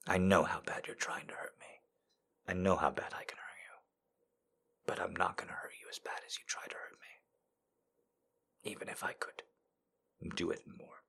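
hushed inner vow, under the breath; breathy whispered mantra. Retold or imitated. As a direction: whisper it like a private promise. Whispering her inner rule.

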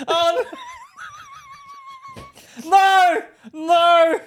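loud, high, hammed-up wail; mock anguished cries. Friend clowning as the wounded superhero, mock anguished cries. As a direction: cry out in comic despair.

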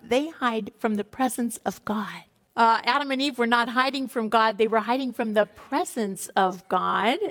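normal talking voice

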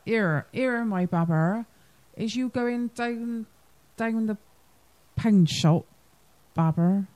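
slow, broad Bristol drawl; Bristolian accent. Shown as a demonstration. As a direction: say it like a Bristol local. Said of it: "our proper Bristolian accent thing".

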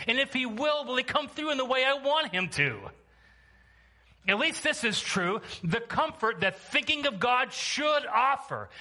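high-pitched, forceful pulpit delivery; impassioned preaching. Real, not acted. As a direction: exhort the church with sustained intensity.

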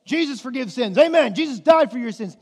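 high, loud mock-preacher proclamations; churchgoers' loud affirmations. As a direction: belt it out like a zealous congregation. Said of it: "They would say".

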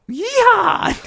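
very high, loud mocking whoop; obnoxious whoop. Runner taunting a driver with an obnoxious whoop. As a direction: let out an obnoxious holler. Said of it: "Like a really obnoxious".

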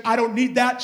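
emphatic quote at pulpit volume; insistent refusal. Retold or imitated. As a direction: preach her refusal firmly. Woman refusing money, preached.

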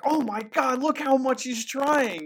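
high, loud mock amazement; mock gushing. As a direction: gush like an overexcited fan. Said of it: "you're like".

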